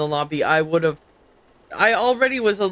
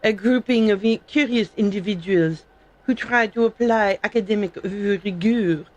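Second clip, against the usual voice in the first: hammed-up French accent, reading in character; amateur mock-French accent; fake French accent. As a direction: put on a bad French accent. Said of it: "That was a terrible fake French accent".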